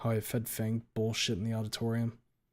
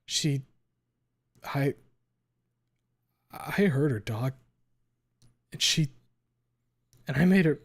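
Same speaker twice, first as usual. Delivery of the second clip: choked-up, stammering through the guilt; broken, halting confession; 4 semitones higher, 6 dB louder than usual. Acted. Anna confessing to her therapist, choking up. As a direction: force out each fragment between sobs.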